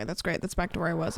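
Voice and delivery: ordinary talk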